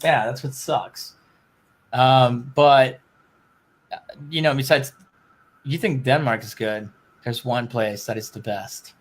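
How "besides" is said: ordinary talk, nothing marked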